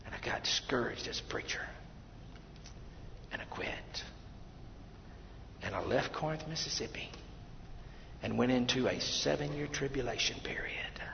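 soft whisper; whispered confession. Real, not acted. Preacher confessing his quitting in a whisper. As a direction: confess it under your breath.

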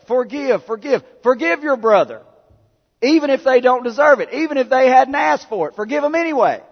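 high, loud, fiery preaching; preaching at full force. Acted. Pastor urging the church to forgive, loud fiery preaching. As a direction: drive each forgive home like a hammer.

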